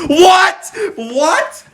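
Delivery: real astonished laugh; laughing disbelief. Real, not acted. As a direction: cry out in amazed laughter.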